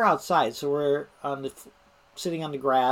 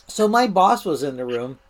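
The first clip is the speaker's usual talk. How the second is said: ordinary talk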